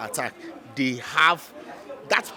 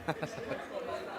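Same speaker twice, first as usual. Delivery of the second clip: soft laugh; 15 dB quieter than usual. Real, not acted. Laughs at Chelsea's goal tally.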